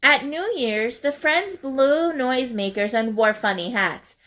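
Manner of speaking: plain reading voice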